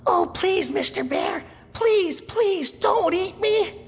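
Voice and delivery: put-on very high, squeaky little boy begging; little boy begging the bear, squeaky and high. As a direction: plead slowly like a scared little boy. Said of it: "talk in a high, squeaky voice like this".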